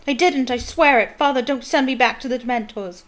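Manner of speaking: high, loud frightened begging; terrified prisoner pleading; sobbing plea to his father; desperate pleading. Acted. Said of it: "shrieked the boy".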